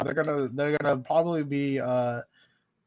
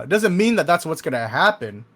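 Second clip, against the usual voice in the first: ordinary talk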